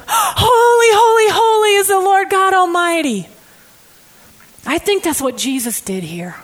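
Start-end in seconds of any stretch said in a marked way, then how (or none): 0.1-3.3 loud, high cry of wonder; higher, louder ecstatic proclamation; awestruck shout. Shown as a demonstration. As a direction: shout it as if seeing God anew. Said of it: "And then".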